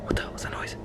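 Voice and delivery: barely voiced, very soft; frightened whisper. Alone in the room hearing a noise, frightened whisper. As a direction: whisper as if something might hear you. Hears a noise while trapped.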